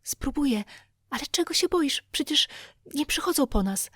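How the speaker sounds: steady a panicking friend; young Tom, nervous. A narrator's reading. Tom answers terrified Huck, boyish and nervous. Tom's voice in dialogue.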